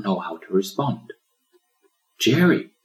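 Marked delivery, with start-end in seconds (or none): 2.2-2.7 higher, louder calling name; exclaiming. A narrator's reading. Pelican introducing turtle, exclaiming. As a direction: call him over. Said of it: "exclaimed the pelican".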